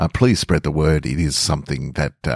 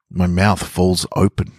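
usual speaking voice

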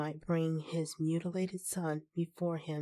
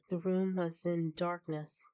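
usual speaking voice

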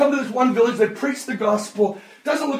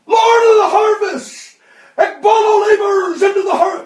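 high, loud pleading cry; loud, high desperate prayer; crying out to God; 9 semitones higher, 6 dB louder than usual. Retold or imitated. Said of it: "begins to cry out to God".

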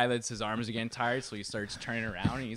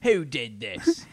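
slow, higher mock English indignation; English accent; 3 semitones higher, 4 dB louder, much slower than usual. English man finding the present at his door, drawn-out English accent. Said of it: "is a, uh, English story".

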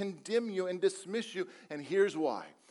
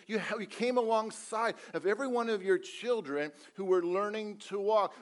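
ordinary talk, nothing marked

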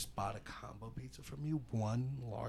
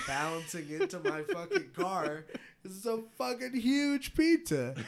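excited; 8 semitones higher, 3 dB louder than usual. Real, not acted. Exaggerating pizza size.

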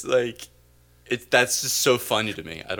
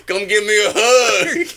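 much higher, loud, slow Southern twang; mock Southern daddy reaching out; over-the-top folksy invitation; Southern hug offer; 11 semitones higher, 10 dB louder than usual. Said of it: "filmed instead in the Deep South".

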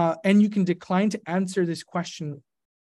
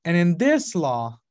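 ordinary talk

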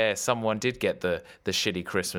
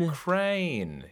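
mock puzzled; 8 semitones higher than usual. Pretending not to know.

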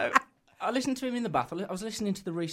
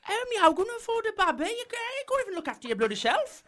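much higher, louder, laughing dad impression; imitating his dad; 6 dB louder than usual. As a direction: son mimics his dad's panicked reaction, laughing. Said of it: "he says".

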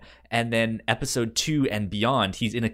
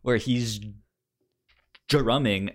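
usual speaking voice

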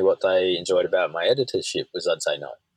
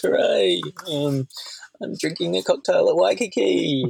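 high, showy bragging voice; mock vain boast; 9 semitones higher than usual. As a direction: show off like a tourist posting photos. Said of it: "the usual vanity of".

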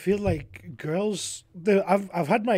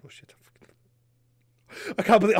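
cracking up; 5 semitones higher, 5 dB louder, slower than usual. Real, not acted. Cracking up while quoting.

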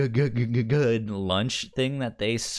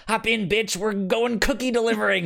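swaggering pal shouting from the car; brash road-trip buddy; 10 semitones higher than usual. Retold or imitated. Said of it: "you're gonna be like".

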